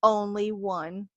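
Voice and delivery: slow, mock-serious ruling; imitated firm but teasing warning; firm yet playful. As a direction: lay it down slowly, playfully stern. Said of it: "very firm yet playful".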